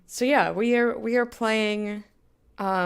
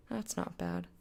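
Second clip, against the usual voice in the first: deep, quieter repeat; low deep voice; 14 semitones lower, 11 dB quieter than usual. Shown as a demonstration. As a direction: drop the voice way down and mutter it.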